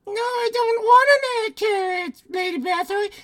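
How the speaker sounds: very high squeaky puppet voice; puppeted dead girl. Acted. Dead girl refusing Lady Bathory's carrot, squeaky puppet voice. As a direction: squeal a refusal like a ventriloquist's dummy. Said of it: "carried on conversations".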